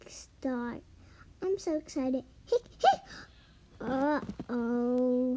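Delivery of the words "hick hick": high, crying hiccups; squeaky hiccups. Acted. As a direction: hiccup with a little whimper. Hiccups come back.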